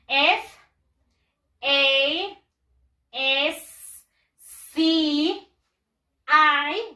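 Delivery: loud, held letters with gaps; spelling out. Shown as a demonstration. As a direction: spell it out for the class, pausing after each. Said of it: "Vamos a deletrearlo también".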